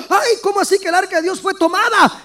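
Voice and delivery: put-on high, alarmed cry of an old priest; frightened cry. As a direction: voice Eli's shock, pitched high. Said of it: "se espantó y dijo". (Elí espantado, voz alta y alarmada)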